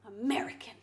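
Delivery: a conspiratorial whisper; hushed teasing guess; whispered reveal. Pretend. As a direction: whisper it like a secret you've uncovered. Teasing reveal of viewer's nationality.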